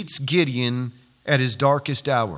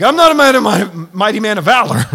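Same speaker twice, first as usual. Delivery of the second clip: loud, high outburst of disbelief; incredulous Gideon; 5 semitones higher, 9 dB louder, faster than usual. Acted. Gideon protesting to the angel, loudly incredulous. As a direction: blurt out a protest, much louder. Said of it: "Now I imagine if I'm Gideon".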